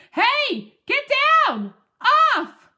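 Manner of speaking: high, very loud frantic orders; shouting. A narrator's reading. Man orders his jumping dog off him, shouting. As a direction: shout each order sharply. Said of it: "flailing his arms and shouting".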